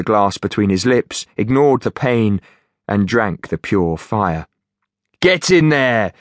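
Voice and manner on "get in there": higher cheering Geordie; Geordie accent. Acted. Finch cheering.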